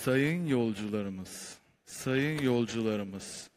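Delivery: slow, low cabin announcement; drawn-out captain's PA call; pilot over the radio. Acted.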